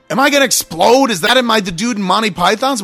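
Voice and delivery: high, loud, indignant demand; exasperated questioning. Retold or imitated. Passenger keeps asking about safety issue, loud exasperated protest. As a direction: demand an answer, voice pushed up.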